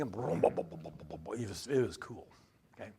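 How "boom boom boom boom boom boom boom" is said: engine boom. Retold or imitated. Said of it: "you start that thing".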